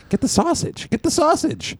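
very high-pitched, pleading repetition; insistent urging. Retold or imitated. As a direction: urge a relative to order it, voice way up. Retold urging at the menu.

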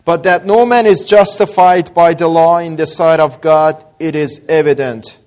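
normal talking voice

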